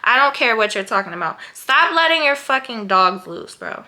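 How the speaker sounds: loud, heated lecture to careless dog owners; heated rant. Real, not acted. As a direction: tell them off, fired up.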